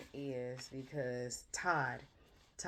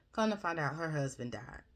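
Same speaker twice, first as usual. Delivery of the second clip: ordinary talk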